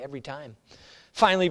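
ordinary delivery

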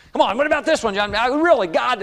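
high, incredulous needling; mocking challenge. Buddies peppering John with questions, making fun. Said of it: "they would make fun".

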